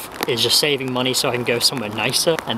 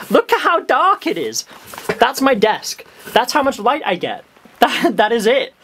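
pitched way up in amused disbelief; amazed disbelief; 8 semitones higher than usual. Real, not acted. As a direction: marvel at how dark it is, almost squeaking. Showing the dark room.

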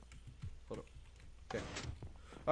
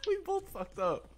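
laughing. Real, not acted. Both failed jump, laughing.

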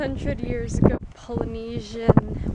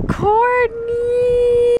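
calling out; 9 semitones higher, 4 dB louder than usual. Real, not acted. Calling out name.

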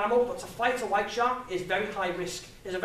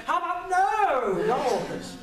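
mock indignant refusal; 8 semitones higher, 3 dB louder, slower than usual. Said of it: "And I went".

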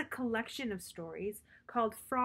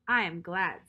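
glad Frog; 3 semitones lower, 7 dB louder than usual. Acted. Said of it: "said Frog".

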